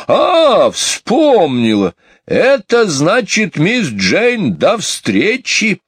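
high, swooping singsong, drawn out; triumphant recollection. Acted. Housekeeper remembers the meaning, high slow triumph. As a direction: burst out with a delighted long cry.

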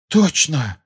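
emphatic, eager exclamation; exclaiming. A narrator's reading. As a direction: exclaim it with sudden certainty. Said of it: "воскликнул он".

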